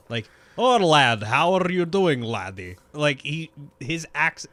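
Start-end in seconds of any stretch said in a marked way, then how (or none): 0.6-2.8 hammed-up Scottish greeting, loud start falling away; attempted Scottish accent, pitch dropping at the end. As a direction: do a rough Sean Connery Scottish brogue. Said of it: "like, Sean Connery".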